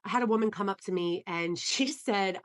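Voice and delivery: ordinary talk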